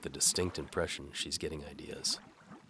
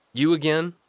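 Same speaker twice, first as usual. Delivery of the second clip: higher, louder challenging guard; gruff guard; 12 semitones higher, 13 dB louder than usual. Acted. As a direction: bark at the unwelcome visitor.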